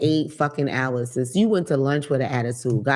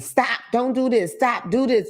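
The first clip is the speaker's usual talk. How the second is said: higher-pitched exasperated scold; 6 semitones higher than usual. Acted. Stylist to distracting people at the shop, scolding. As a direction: tell them off, voice raised in pitch.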